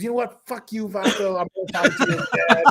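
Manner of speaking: high, tough-guy voice spitting it out; Noel G impression. Guest storming off the podcast, high tough-guy snarl. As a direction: play the angry movie tough guy storming off. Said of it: "I did the guy from Fast and the Furious".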